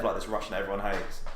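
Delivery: normal talking voice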